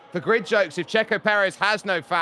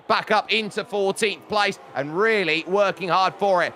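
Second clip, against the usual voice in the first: raised, excited play-by-play; live race commentary; 6 semitones higher than usual. Real, not acted. Commentator following the fight for points, excited live commentary. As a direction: call the midpack battle with high energy.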